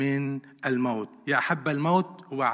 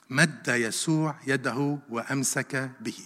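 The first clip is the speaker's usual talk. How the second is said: slow, measured, lower repeat of the verse; slow emphasis; 3 semitones lower, 5 dB quieter, slower than usual. Shown as a demonstration. Preacher repeats the verse of rescue to the congregation, slowly. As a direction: let each word sink in, slowly.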